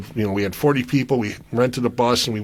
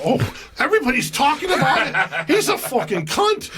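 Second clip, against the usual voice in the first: high voice through laughter; laughing gossip; 13 semitones higher than usual. Retold or imitated. Musician telling the storyteller the news, laughing. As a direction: gleefully spill the gossip. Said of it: "musician answering, laughing".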